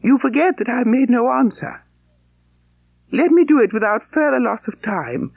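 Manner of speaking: put-on firm young woman. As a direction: turn him down politely but with no give. Elizabeth firmly refusing Collins.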